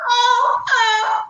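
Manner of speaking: high, hammed-up wail; mock crying wail. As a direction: wail it like a crybaby in a stall. Retelling crying in bathroom stall.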